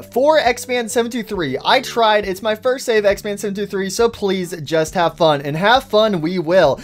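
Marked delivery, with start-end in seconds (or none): none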